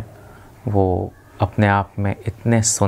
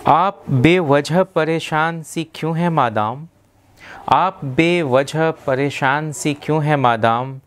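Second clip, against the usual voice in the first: poetry recitation; 4 semitones higher than usual. Acted. Reciting Sahir's poem addressed to a Madam, measured poetic declamation. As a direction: recite it as Urdu verse, line by measured line.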